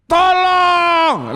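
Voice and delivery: high, loud drawn-out shout; shouted cry for help. Shown as a demonstration. Preacher jolts sleeping congregation awake, loud shout. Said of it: "katakan yang keras".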